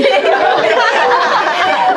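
high burst of laughter. Real, not acted. Laughing hard at the master's calm reply.

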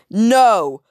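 mock emphatic no; Miss Hattie's no. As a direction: snap a firm refusal. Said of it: "Miss Hattie was saying this".

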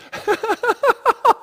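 high burst of laughter; embarrassed laugh. Real, not acted. Preacher catching an accidental pun, laughing. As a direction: laugh at your own slip. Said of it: "that was a bad joke".

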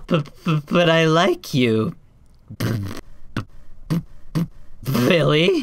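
stammering, lovestruck plea; halting, nervous flirt; Rebecca flirting. Acted. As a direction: stutter helplessly on the beloved's name. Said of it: "if Rebecca was the one flirting".

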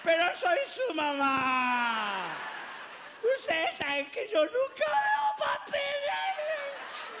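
put-on high, loud slurred drunk shouting; drunk weepy mother. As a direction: wail love at the kids while drunk. Drunk mother crying to kids.

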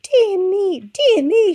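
high, loud gasping hen. A narrator's reading. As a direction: gasp it out in alarm. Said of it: "she gasped".

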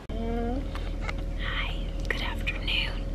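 whisper, don't wake her. Real, not acted. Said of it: "I have to whisper because Freya's going to sleep".

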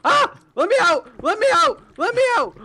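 high, loud trapped yelling; panicked shout. Real, not acted. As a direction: scream to be let out.

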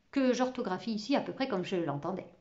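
plain reading voice

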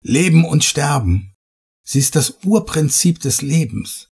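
rising zeal for the idol; enthusiastic. A narrator's reading. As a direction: preach her greatness, carried away. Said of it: "einen begeisterten Blick".

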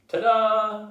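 high, loud fanfare; warbling. A narrator's reading. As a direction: sing out a grand reveal. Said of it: "I warbled".

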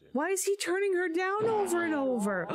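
high-pitched incredulous question after a gasp; gasp, then pitch it up in disbelief; dismayed disbelief. Real, not acted. Watching Aaron turn her down, high dismayed disbelief. Dismayed at repeated refusal in game.

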